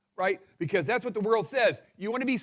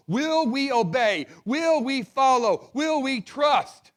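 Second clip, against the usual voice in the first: louder, slow, each "Will" hit hard; building preacher's call; 4 semitones higher, 6 dB louder, slower than usual. Real, not acted. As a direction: drive the questions home to the congregation. Preacher's climactic repeated questions.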